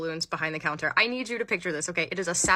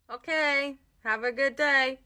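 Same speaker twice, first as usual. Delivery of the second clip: high, sing-song polite brush-off; placating high-pitched farewell; humoring the customer; 6 semitones higher, slower than usual. Acted. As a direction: send them off with put-on politeness. Manager humors ranting customer.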